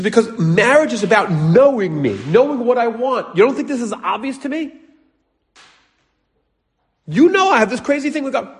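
high-pitched mock upset protest; upset wife. Upset wife explaining marriage to clueless husband, high exasperated plea. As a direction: play a wife pleading, worked up and high. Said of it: "She gets all upset. She starts crying".

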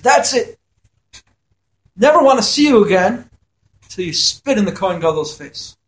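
a hammed-up furious husband, NEVER pushed high; imitating a husband blowing up at his wife; angry husband. Angry husband voiced in story.

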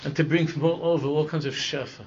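ordinary delivery

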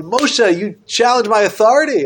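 God sternly rebuking Moshe; strong stern rebuke. Acted. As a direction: scold Moshe from on high. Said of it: "uh, strong".

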